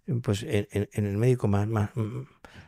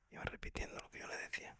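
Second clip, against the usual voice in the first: hushed whisper, almost no voice; 23 dB quieter, faster than usual. Real, not acted. Doctor recalling a dying patient's prayer, whispered. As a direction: breathe it out softly, as if at a deathbed. Tender deathbed moment, whispered aside.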